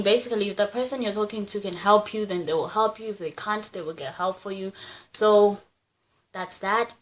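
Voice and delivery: ordinary talk, nothing marked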